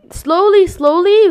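normal talking voice

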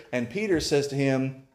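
ordinary talk, nothing marked

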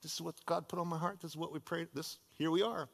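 soft, quiet, simple trust; a touch of dreamy childlike faith. Retold or imitated. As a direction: hush it like a sure child. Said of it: "She just got this childlike faith".

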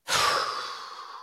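long, breathy sigh of frustration; heavy wordless exhale; exasperated sigh. Real, not acted. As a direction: let out a big fed-up sigh. Fed up with the show.

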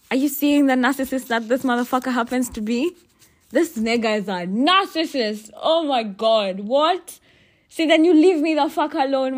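loud, high, exasperated rant; real outrage. Real, not acted. Venting to listeners about her narcissist ex, loud and high. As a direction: let the fury climb to a shout.

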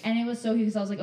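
usual speaking voice

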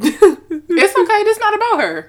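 laughing while reassuring; giggling through the words. Real, not acted. Both laughing about grandmother.

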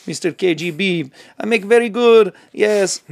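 put-on loud, hammy Russian accent; badly faked Russian dictator voice. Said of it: "how do you do Russian accent".

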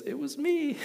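timid confession to an armed man; sheepish admission. Retold or imitated. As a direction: squeak it out timidly. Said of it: "lifts his hand and goes".

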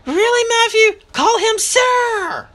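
high, loud, drawn-out reprimand; sharp scolding. A narrator's reading. Mother scolding Matthew before a guest, sharp and high. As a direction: scold the boy, pitched right up. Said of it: "Mama said sharply".

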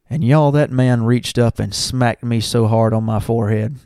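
ordinary talk, nothing marked

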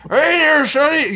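loud, high, hammed-up old geezer; bad old man voice. Shown as a demonstration. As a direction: yell it like a cranky old man. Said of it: "Mine's is terrible".

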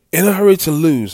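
usual speaking voice

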